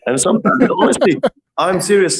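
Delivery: high, laughing insistence. Real, not acted. Tattoo artist insisting to amused interviewer, laughing. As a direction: insist you're serious through laughter.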